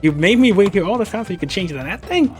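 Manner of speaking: high, loud incredulous complaint; indignant outburst. Acted. Captive scolding rescuer for changing clothes, indignant yell. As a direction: complain at full voice, outraged.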